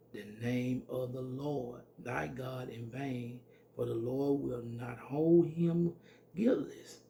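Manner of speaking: slow, solemn Bible reading; measured pulpit scripture voice. Acted. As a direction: read the commandment slowly and gravely. Preacher reads scripture aloud.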